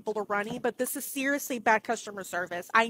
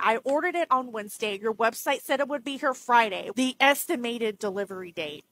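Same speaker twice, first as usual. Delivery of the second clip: usual speaking voice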